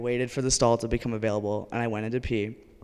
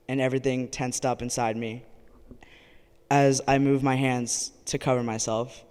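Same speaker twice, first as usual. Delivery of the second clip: ordinary talk, nothing marked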